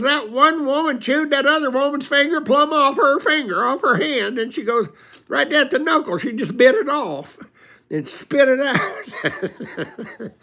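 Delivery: high, loud scandalized wife, laughing at the end; gossiping wife. Retold or imitated. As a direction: tell it shocked and thrilled, then slow down laughing. Wife retelling fight, voiced.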